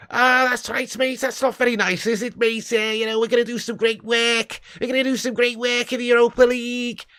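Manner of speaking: put-on high-pitched caricature of a Scouse accent; mock Scouse accent. Mimicking Liverpool fans about Europa League, mock Scouse accent. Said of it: "I can do a fucking Scouse accent now".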